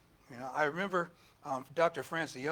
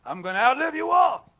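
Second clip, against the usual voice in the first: mock vow; 10 semitones higher, 13 dB louder than usual. Pastor retelling his youthful boast.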